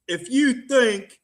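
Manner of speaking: high put-on voice of a blunt TV advisor; Doctor Phil impression. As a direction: lampoon a TV doctor telling someone off. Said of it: "Doctor Phil's words of wisdom, folks".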